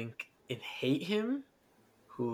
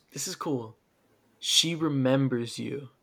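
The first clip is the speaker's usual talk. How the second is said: usual speaking voice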